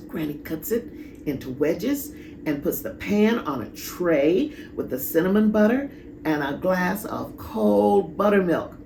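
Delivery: plain reading voice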